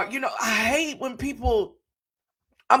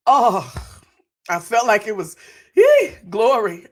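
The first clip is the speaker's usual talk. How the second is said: joyful outburst; 7 dB louder than usual. Real, not acted. Overcome by God's words.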